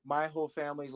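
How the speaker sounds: normal talking voice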